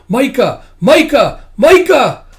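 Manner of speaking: very high, loud screamed name, building; furious scream. Acted. Tuya in tears in her dream, furious screaming.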